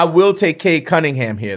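ordinary talk

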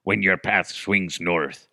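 hammy pirate reading a treasure poem. Pretend. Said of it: "if I read it as a pirate".

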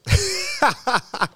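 a loud, high laugh; high burst of laughter. Real, not acted. Comic laughs at his own will punchline. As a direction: crack up high.

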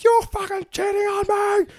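high shrieking yell; furious scream. Retold or imitated. Girlfriend screaming at him in the cop car, shrieking. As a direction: screech it like a betrayed girlfriend. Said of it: "It's like".

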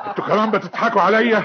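high, loud wounded shout; outraged at mockery. Cheated man shouting at those laughing, outraged. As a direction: turn on the laughing crowd.

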